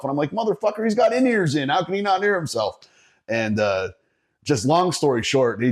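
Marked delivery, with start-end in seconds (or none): 0.3-2.8 high-pitched exasperated retort, much higher; exasperated outburst. Retold or imitated. Sound guy snapping back at the guitar player, exasperated and pitched high. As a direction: shoot back in disbelief, pitched way up.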